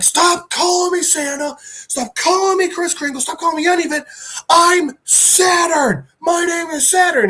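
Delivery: high, strained villain tirade; acted furious shout. Villain corrects heroes on his name, acted furious shout.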